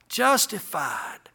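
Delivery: a slow, hushed whispered word. Acted. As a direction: let the word fall to a whisper. Whispered word within sermon.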